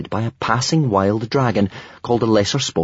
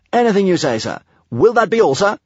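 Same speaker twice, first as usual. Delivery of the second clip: high, eager soldier's reply; dutiful, pitched-up military voice; military promptness; 10 semitones higher than usual. A narrator's reading. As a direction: report promptly like a cadet at attention. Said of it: "answered with military promptness".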